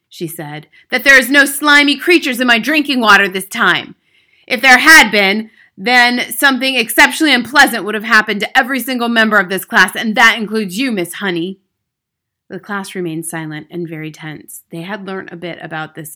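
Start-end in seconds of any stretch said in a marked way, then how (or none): 0.9-11.6 loud, menacing headmistress; loud, overbearing bully; domineering Trunchbull. Acted. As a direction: project a threat at the whole class.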